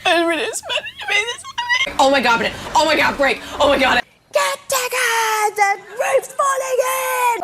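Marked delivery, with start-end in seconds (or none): 4.3-7.4 high, slow alarmed cry; hammed-up high panic shout; panicked cry. Acted panicked cry in story.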